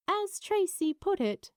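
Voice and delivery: usual speaking voice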